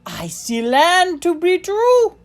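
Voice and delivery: high, loud, drawn-out cry of land sighted; excited shout. A narrator's reading. Timothy calling from the raft, joyful shout. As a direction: call out the sighting with joy. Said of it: "I heard Timothy shout".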